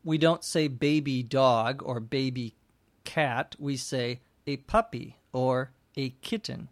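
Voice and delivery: usual speaking voice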